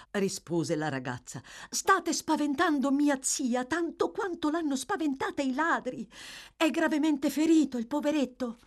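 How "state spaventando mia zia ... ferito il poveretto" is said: high feminine voice, gently reproving. A narrator's reading. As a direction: voice a concerned young lady. Narrator voices the young lady.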